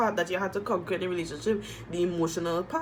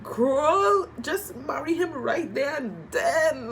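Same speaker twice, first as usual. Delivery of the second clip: excited urging; 7 semitones higher, much slower than usual. Retold or imitated. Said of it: "I'm like".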